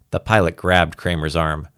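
ordinary delivery

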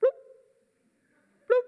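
a high, drawn-out bloop like a drop of water; a squeaky, stretched-out drip sound; mock drip noise. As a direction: play the feeble shower with your mouth. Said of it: "wait forever that".

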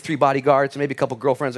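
usual speaking voice

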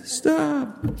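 very high, thin drawn-out call; mock calling out. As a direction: ineffectual high-pitched call to scattering kids. Imitates calling to scattering kids.

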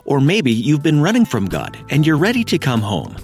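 ordinary delivery

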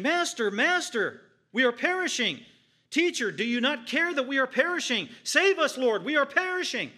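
high, loud desperate plea for help; panicked cry. Acted. Disciples crying to Jesus in the storm, loud and panicked. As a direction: cry out to the sleeping master as the boat sinks.

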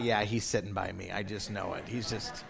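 low, soft thinking to himself; quiet resigned aside to yourself; muttered inner thought. Retold or imitated. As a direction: mutter it under your breath, low. His own uneasy inner thoughts.

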